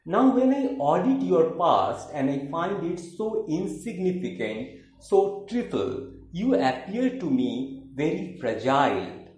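emphatic declaimed verse with high phrase openings; theatrical poem reading with swooping intonation; poetry recitation. Acted. Recites third poem, In Return.

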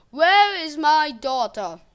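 slow, loud, deadly serious demand; cold, measured threat; calm and deadly serious. A narrator's reading. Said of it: "voice was calm and deadly serious".

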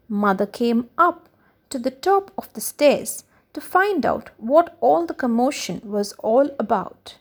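ordinary delivery, nothing marked

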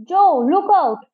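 high, drawn-out warning yell. A narrator's reading. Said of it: "Frank yelled in my ear".

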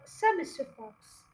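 ordinary delivery

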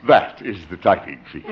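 normal talking voice

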